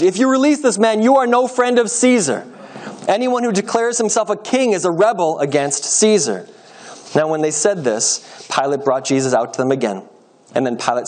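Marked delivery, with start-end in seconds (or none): 0.0-6.5 high, forceful protest, short of a full shout. A narrator's reading. Leaders pressure Pilate against releasing prisoner, restrained shouting. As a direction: cry out a warning to the governor.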